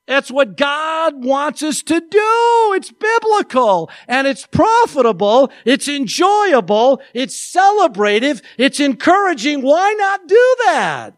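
building, rising, slow and emphatic sermon; fervent preaching. Acted. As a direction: preach it with growing fire. Preaching at full force.

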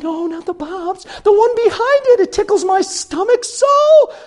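put-on very high, drawn-out girly voice; girlfriend imitation. Imitating girlfriend at amusement park.

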